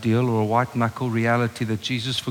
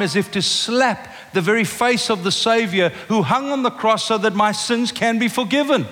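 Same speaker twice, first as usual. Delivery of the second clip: raised, high, impassioned preaching; preaching at full force; 7 semitones higher than usual. Acted. Preacher pressing the congregation on forgiveness, impassioned and raised. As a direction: drive the point home to the whole church.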